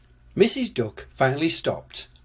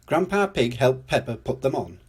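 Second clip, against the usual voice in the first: plain reading voice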